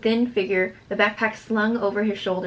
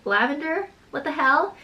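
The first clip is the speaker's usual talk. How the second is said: higher, questioning mimic of another girl; mocking mimicry; 6 semitones higher than usual. A narrator's reading. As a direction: imitate her incredulous questions, pitched up. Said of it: "She mimics".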